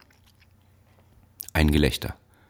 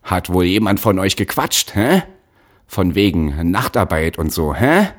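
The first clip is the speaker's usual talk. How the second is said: loud, angry interrogation; raised-voice bullying questions; yelling; 3 semitones higher, 6 dB louder than usual. A narrator's reading. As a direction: bellow accusingly, each "hä" jumping up. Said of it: "In seine alte Lautstärke zurückfallend schrie er".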